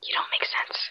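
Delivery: hushed whisper; whispering while hiding. Real, not acted. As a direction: say it under your breath. Hiding player whispers retort.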